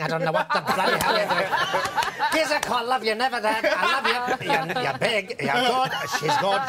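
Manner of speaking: a fond exasperated mother on the answerphone; mock exasperated mum on the phone. Mum's answerphone message progressed.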